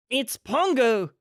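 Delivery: high, slow cry of recognition; glad cry. A narrator's reading. Mister Dearly recognizes his dog, a high slow cry. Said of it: "cried Mister Dearly".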